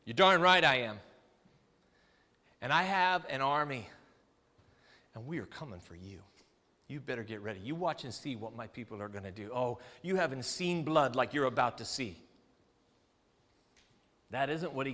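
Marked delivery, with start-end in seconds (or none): 0.1-3.9 firm, defiant boast; proud, combative admission; defiant rebel. Acted. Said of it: "Defiance, anger, threats". 5.1-12.2 low, soft menace; rebel's low warning of bloodshed; menacing threat. Acted.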